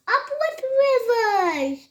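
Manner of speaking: mild joyful hoot. A narrator's reading. Young dinosaur cheers the river, hooting. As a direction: hoot it high, like a happy cheer. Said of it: "And he hooted".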